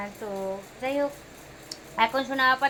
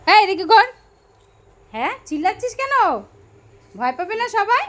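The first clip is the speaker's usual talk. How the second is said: playful scolding; 8 semitones higher, 3 dB louder than usual. Real, not acted. Mother asks baby why he's shouting, high playful scolding.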